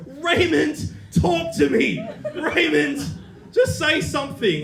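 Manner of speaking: Mum's high, loud, pleading shriek of Raymond; hysterical. Retold or imitated. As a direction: beg your son to talk to you, half-hysterical and laughing. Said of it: "she's almost hysterical".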